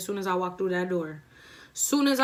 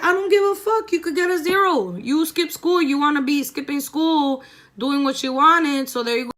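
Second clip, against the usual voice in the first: higher and louder yelling; mock furious mom; 6 semitones higher, 5 dB louder than usual. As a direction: imitate mom blowing up. Mom yelling about skipping school.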